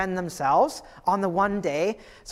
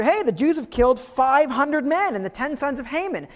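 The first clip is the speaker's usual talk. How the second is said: higher, offhand king voice; flippant king; 6 semitones higher than usual. Retold or imitated. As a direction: toss it off like it's nothing. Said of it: "he seems so flippant about it".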